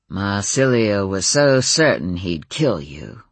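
broad flat Boston A's, slow; Kennedy-style Boston accent. A narrator's reading. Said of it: "like one of the Kennedys".

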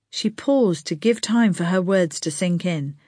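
plain reading voice

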